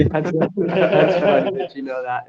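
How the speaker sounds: amused praise through laughter; laughing. Real, not acted. Surprised the learner knows the word memes, laughing.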